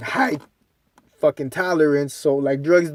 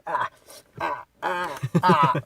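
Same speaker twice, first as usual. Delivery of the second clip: softer mock whimper of burning; hammed-up pained [name]; 6 dB quieter than usual. [name] after snorting, mock pained whimper. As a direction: groan like your nose is on fire.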